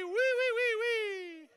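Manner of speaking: very high, loud piping wee wee; high falsetto wees. Shown as a demonstration. Coach leading falsetto sounds, very high. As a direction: pipe like a tiny bird.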